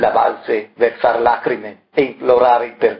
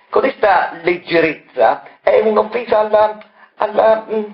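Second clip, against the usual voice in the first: higher, indignant, stumbling over the missing word; groping for words; 9 semitones higher than usual. Acted. Dr Moroni scolding a colleague, indignant and groping for words. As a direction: sputter in offense and lose the word. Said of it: "annaspò alla ricerca della parola adatta".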